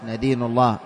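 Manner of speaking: "ندين" opened with "na", as in "nadinu"; short a after the first nun of "ندين"; fatha on the nun. Shown as a demonstration. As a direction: say "ندين الله" as "nadinu llah". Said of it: "لكن الصواب بالفتح".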